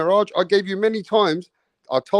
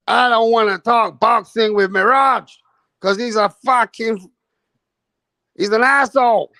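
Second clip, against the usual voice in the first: higher, louder dismissive yell; mock angry yell; 6 semitones higher, 5 dB louder than usual. Imitating the guest shouting at Mirage, mock yell. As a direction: shout him down as the rival. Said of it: "He said".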